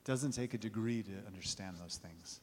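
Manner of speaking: soft, intimate reflection; quiet sermon close. Acted. Preacher closes quietly to the congregation, soft and intimate.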